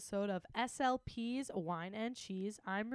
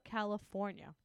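ordinary talk, nothing marked

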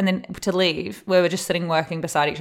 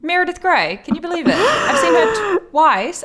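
much higher and louder, gushing; excited; 12 semitones higher, 6 dB louder than usual. Real, not acted. Excited about celebrity sighting.

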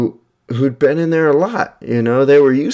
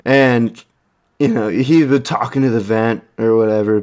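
ordinary talk

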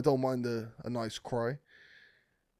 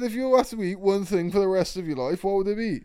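louder, higher, laughing disbelief; incredulous re-read; 8 semitones higher, 7 dB louder than usual. Shown as a demonstration. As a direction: read it back like you can't believe it. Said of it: "She said, she said thing".

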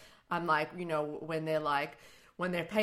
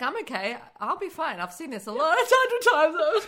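higher and louder, laughing and crying at once; mock brave through tears; 10 semitones higher, 9 dB louder than usual. Teasing cohost about watching Up, laughing mock-tearful. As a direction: pretend to hold it together while laughing. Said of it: "you're like".

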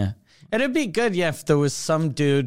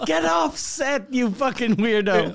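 mock film crew yell; 6 semitones higher than usual. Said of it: "were people yelling at you going".

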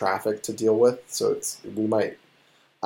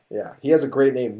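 ordinary delivery

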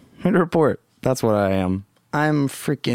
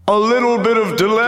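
1 delay effect; 10 semitones higher, 3 dB louder, faster than usual. Pretend. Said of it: "this Evil Lord has the power of".

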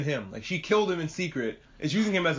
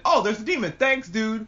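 higher-pitched imitated realization; mock pleasant surprise; 6 semitones higher than usual. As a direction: voice a sudden grateful discovery, higher. Voicing Tanjiro's reaction mid-air.

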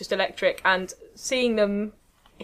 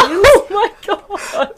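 high, loud, drawn-out laughing squeal; real thrilled outburst; 13 semitones higher, 12 dB louder, much slower than usual. Real, not acted. Friend hearing of a TV-dad sighting, thrilled and laughing. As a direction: shriek it with delight through laughter.

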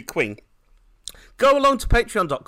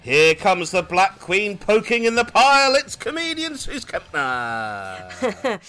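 big, high, loud host intro; podcast announcer; 8 semitones higher, 6 dB louder, slower than usual. Acted. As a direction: announce the guest with long drawn-out words. Hosts the show intro.

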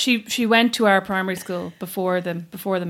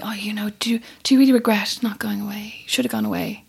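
hushed, mock-concerned relatives; an unvoiced whisper of family pestering; whispered nagging. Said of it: "always kinda saying to me".

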